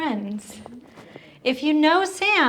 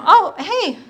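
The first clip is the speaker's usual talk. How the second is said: normal talking voice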